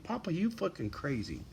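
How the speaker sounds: soft, lightly voiced dog speaking to her papa; a hint of a teasing dog voice; voicing the dog. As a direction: quietly put words in the dog's mouth.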